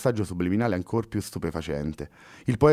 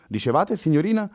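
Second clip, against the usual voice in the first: higher, rising, curious officer; eager policeman; 9 semitones higher, 3 dB louder than usual. Acted. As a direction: hang on her words. Policeman prompts the girl.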